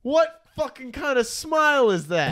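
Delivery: high-pitched real disbelief; incredulous. Real, not acted. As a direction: ask it aghast, voice pitched well up. Still reacting to the photo.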